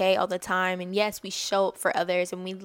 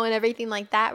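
ordinary delivery

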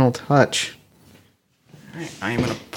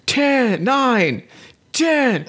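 high-pitched, showy gym yell; obnoxious screaming; 12 semitones higher, much slower than usual. Acted. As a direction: scream out reps like an obnoxious lifter. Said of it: "I'm, like, screaming".

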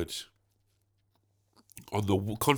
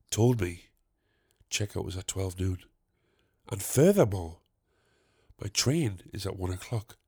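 soft, lower, overly proper explanation; eloquent and formal; 6 semitones lower, 6 dB quieter than usual. Retold or imitated. Explaining to the hotel lady in a mock-formal, softer voice. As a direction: play it like a calm lawyer making his case. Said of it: "very eloquently put".

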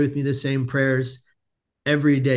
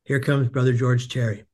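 ordinary talk, nothing marked

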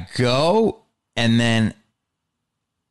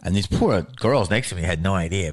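normal talking voice

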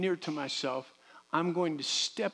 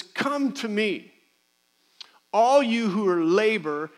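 scripture quote, slow; 5 semitones higher, 5 dB louder, slower than usual. Retold or imitated. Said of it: "but he says".

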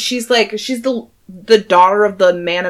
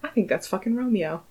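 hushed suspicion; 2 semitones higher, 10 dB quieter than usual. Acted. Tybalt overheard muttering his suspicion.

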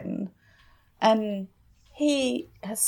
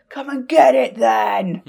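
loud, drawn-out roar in imitation; higher, much louder, slow mock scream; imitated scream; 4 semitones higher, 8 dB louder, slower than usual. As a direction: mimic a villain screaming, stretching the words. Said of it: "then he screams".

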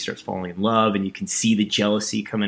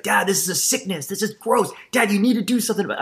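higher-pitched, mock outraged whine to dad; indignant complaint; 9 semitones higher than usual. Son protesting to his dad after getting kicked out, mock indignant. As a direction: imitate a disgusted plea, pitched up high.

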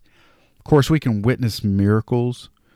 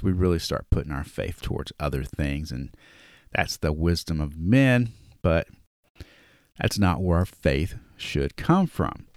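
normal talking voice

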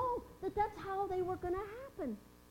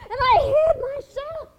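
a slow, frightened cry, much higher and louder; 7 semitones higher, 14 dB louder than usual. Acted. Mary recalling hiding from the angel's light, frightened outcry.

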